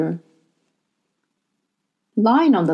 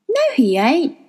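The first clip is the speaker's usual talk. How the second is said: put-on young girl voice; 7 semitones higher than usual. A narrator's reading. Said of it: "Lyra said".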